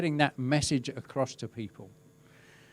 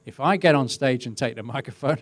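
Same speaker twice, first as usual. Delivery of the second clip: usual speaking voice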